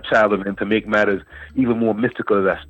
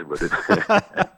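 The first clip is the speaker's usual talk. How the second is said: laughing it out on a high note; 10 semitones higher than usual. Real, not acted. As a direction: break into a laugh on the word brother. Laughing at his own story.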